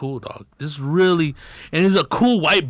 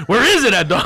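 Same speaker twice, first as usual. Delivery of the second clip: higher, louder, laughing through it; laughing quote; 5 semitones higher, 4 dB louder than usual. Retold or imitated. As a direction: laugh while asking where it is. Retelling search, laughing.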